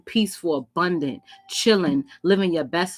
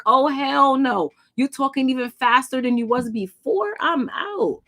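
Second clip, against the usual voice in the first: sassy refusal; 7 semitones higher than usual. Retold or imitated. You refusing the ex's fast talk.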